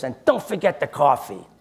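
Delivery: imitating a cranky man's demand; comic put-on cantankerous voice; mock angry demand. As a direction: yell it as a hammed-up grouchy order. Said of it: "he's angry at the whole world".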